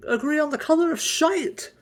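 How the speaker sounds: high, loud scolding outburst; shrill angry Kendall. Acted. Kendall scolding him over the breakfast supplies, shrill and angry. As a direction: yell at him for using up supplies. First take, said again.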